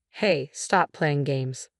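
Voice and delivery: ordinary delivery, nothing marked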